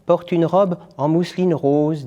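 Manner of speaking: put-on the boy. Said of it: "dit le garçon".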